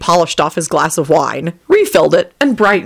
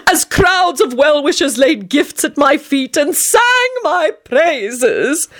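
high, slow, sing-song boast; trilled; 8 semitones higher, slower than usual. A narrator's reading. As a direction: trill out the grandeur. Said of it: "he trilled".

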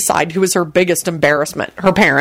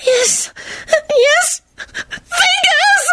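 very high, quavering cry of rapture; hammed-up orgasmic wail; ecstatic wail; much slower than usual. A narrator's reading. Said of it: "she wailed as if in the throes".

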